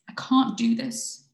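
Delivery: loud, high, drawn-out plea; overwhelmed protest. Acted. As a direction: cry out at breaking point. Voiced partner panicking in a crisis.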